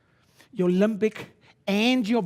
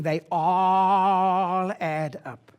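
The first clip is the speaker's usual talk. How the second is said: drawn out; 4 dB louder, much slower than usual. Shown as a demonstration. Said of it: "stretching it out".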